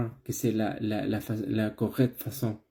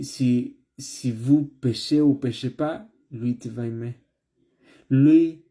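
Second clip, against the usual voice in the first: building preaching; 3 semitones higher, 4 dB louder than usual. Acted. Preaching builds toward the close.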